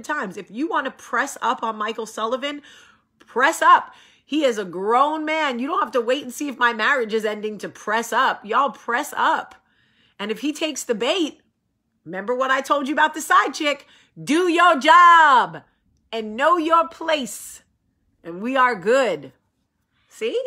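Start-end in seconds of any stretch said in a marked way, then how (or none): 3.2-3.9 louder, higher dare to go ahead; defiant dare. Real, not acted. Daring fans to go ahead. 14.2-17.6 slow, loud laying down the law; stern warning. Real, not acted. Lecturing the side chick.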